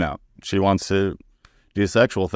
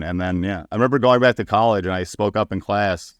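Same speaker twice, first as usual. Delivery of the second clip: normal talking voice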